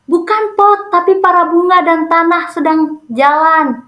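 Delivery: high, teasing imitation of a heckler; mocking. Another older student adds to the jeer at Ayu's group, mocking. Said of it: "sambil ngeledek".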